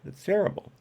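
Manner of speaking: "It's terrible." in a high mock Transylvanian accent. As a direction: groan "It's terrible." as a hammy vampire. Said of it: "devolved into a Transylvanian accent".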